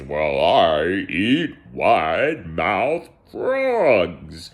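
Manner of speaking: very slow, menacing storybook monster; hammed-up monster threat, stretched long; scary monster. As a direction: drag out every word with mock menace. Voices the monster in tale.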